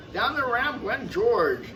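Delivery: ordinary delivery, nothing marked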